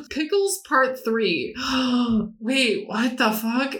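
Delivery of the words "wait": normal talking voice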